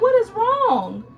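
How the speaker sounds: high, loud, disbelieving mom voice; exasperated question to her son. Retold or imitated. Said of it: "I'd be like".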